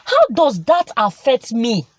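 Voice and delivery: ordinary delivery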